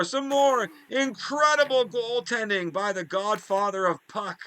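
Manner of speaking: lively hockey commentator calling the action; play-by-play announcer. Acted. Gamer commentating his hockey game to viewers in announcer voice.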